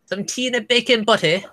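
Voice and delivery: high-pitched playful Mancunian; mock Manchester accent.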